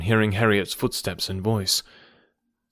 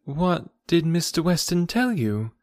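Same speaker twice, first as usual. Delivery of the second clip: higher-pitched, puzzled question; a touch of confusion; perplexed; 7 semitones higher than usual. A narrator's reading. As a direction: ask in mild bewilderment. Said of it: "still perplexed".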